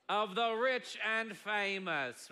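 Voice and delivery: drawn-out TV host announcing a show; slow, showy host impression; Robin Leach. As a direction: stretch out the show title grandly, like a presenter. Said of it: "his name was Robin Leach".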